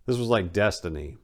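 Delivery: ordinary delivery, nothing marked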